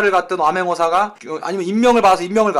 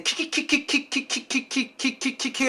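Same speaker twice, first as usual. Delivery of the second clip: imitated snicker; 6 semitones higher, 6 dB quieter than usual. Imitated giggling.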